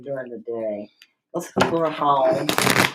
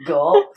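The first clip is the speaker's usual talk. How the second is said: imitated gulp; 11 dB louder than usual. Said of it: "hear me swallowing".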